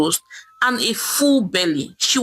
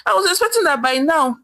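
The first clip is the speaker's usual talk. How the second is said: higher, desperate pleading husband; husband in jail pleading with his wife; 6 semitones higher than usual. Acted. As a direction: beg with a catch in the voice. Jailed husband pleads on phone.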